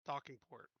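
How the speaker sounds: ordinary delivery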